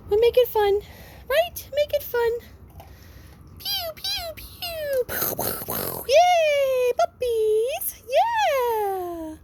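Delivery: squealing pet praise; excited puppy talk. Real, not acted. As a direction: make it sound like a party. Making fireworks fun for pups.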